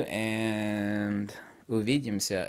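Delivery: увидимся said slowly in a Russian accent; Russian goodbye. Shown as a demonstration. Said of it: "as the Russians would say".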